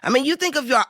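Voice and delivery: ordinary talk, nothing marked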